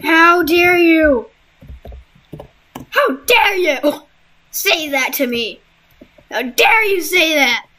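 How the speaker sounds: slow, high, affronted "how dare you"; indignant outrage. Pretend. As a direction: act deeply offended, drawn out. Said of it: "I did the perfect voice for that".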